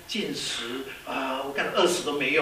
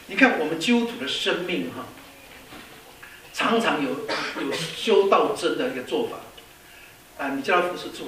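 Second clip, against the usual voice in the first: ordinary talk, nothing marked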